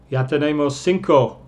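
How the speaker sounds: ordinary talk, nothing marked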